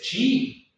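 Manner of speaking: chi with Spanish CH sound; spelling pronunciation. Shown as a demonstration. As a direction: say chi as in chihuahua. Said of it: "pero se pronuncia chi".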